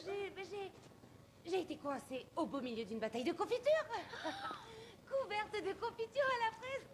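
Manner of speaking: high, climbing, giggly tall tale; playful exclaiming. Acted. Teacher explains the mess to children, playfully exclaiming. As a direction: pitch it high as a merry mishap. Makes light of danger before children.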